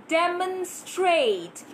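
teacher drilling "demonstrate" slowly, vowel as in "den"; "demonstrate" said slowly with "de" as "deh". Shown as a demonstration. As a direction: stretch out "demonstrate", first vowel an open e. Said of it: "Let's try to repeat these words".

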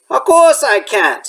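a hint of big friendly Hagrid refusing; light Hagrid voice, firm no.

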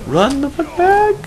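high, loud panicked shout; panicked yell. Real, not acted. Panicked yelling in game.

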